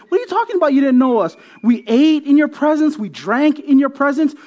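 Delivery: high, emphatic voice of disbelief; acted indignant protest. The people answering Jesus at the door, indignant protest. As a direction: argue back, pitched well up.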